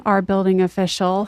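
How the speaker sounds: normal talking voice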